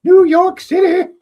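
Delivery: high, loud, drawn-out commercial catchphrase; salsa commercial impression. Podcast host quoting an old salsa commercial, hammed-up ad voice. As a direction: belt out a slow, high-pitched ad line. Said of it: "one of the dumb commercials".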